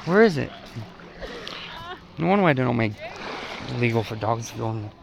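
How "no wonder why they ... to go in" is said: ordinary talk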